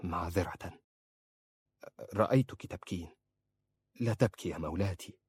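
soft, gentle reassurance. Acted. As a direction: speak softly to comfort her. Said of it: "وقال في رفق". (بصوت رقيق هادئ)

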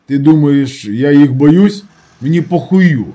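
loud, indignant challenge; defiant retort. A narrator's reading. Injured man dismissing fear of the arsonists, loud and defiant. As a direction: throw it back loudly, defiantly.

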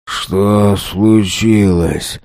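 groggy, very slow mumble; barely able to move the tongue; slurred mumble. A narrator's reading. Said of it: "Еле ворочая языком, пробормотал".

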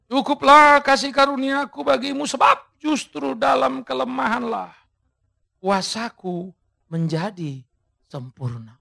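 God answering Paul; God's word proclaimed. Acted. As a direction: declare it then let it settle. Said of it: "Jawab Tuhan padaku".

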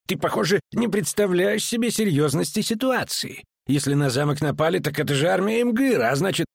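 high-pitched agitated protest; high, alarmed tirade; shouted. A narrator's reading. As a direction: argue urgently in a high voice. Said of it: "крикнул он".

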